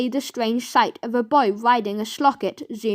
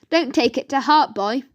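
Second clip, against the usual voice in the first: ordinary delivery, nothing marked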